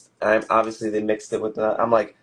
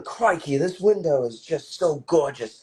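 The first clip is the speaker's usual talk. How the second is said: crocodile hunter voice; 6 semitones higher, 4 dB louder than usual. Pretend. Co-host riffing on the documentary bit, mock crocodile hunter. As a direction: gush over the window like a croc hunter. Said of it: "or you do like the crocodile".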